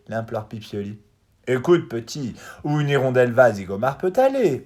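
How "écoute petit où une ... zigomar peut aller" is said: loud, high, sure-of-himself bird; self-assured. A narrator's reading. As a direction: talk down to the little one, full of confidence. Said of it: "répond le merle avec assurance".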